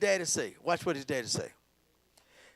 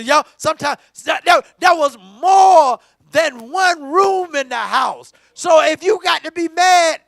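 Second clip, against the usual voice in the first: loud, shouted gospel preaching; preaching at full force; 6 semitones higher, 9 dB louder than usual. Acted. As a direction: call the whole church in, at full voice. Preaching climax, calling folks in.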